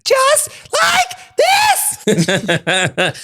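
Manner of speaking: high, slow, laughing put-on voice; mocking AJ's old voice, high and drawn out. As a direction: squeak it out slowly like his old self. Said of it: "sounded so different".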